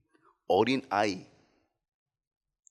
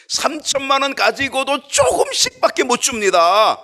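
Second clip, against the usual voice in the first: Philip's objection; 11 semitones higher, 9 dB louder than usual. Retold or imitated. Preacher voices Philip's objection.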